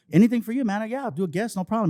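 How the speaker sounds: acted-out accommodating reply; eager agreement. Acted eager reply to club manager.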